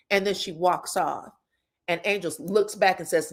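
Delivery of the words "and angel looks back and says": usual speaking voice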